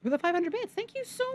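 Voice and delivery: very high-pitched thanks, softening at the end; excited squeal. Real, not acted. Thanking a viewer for bits.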